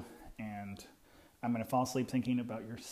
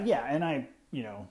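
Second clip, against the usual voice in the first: ordinary talk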